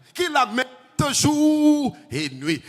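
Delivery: jour et nuit étiré, haut et fort; drawn out. Shown as a demonstration. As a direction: appuyer lentement sur « jour et nuit ». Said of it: "Il est en train de méditer". (« jour et nuit » traîné et appuyé)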